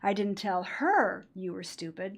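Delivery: "her" lifted and drawn out, slow delivery; slow line with emphasis on "her"; stress on HER. Shown as a demonstration. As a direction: lean on the word "her". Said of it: "You told somebody else".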